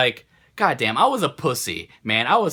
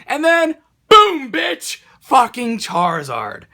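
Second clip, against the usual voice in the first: triumphant yell; 9 semitones higher, 6 dB louder, slower than usual. Real, not acted. Reveals pulling the Charizard.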